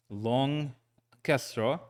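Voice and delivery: ordinary talk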